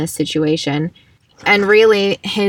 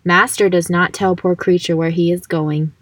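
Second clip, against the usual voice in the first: ordinary talk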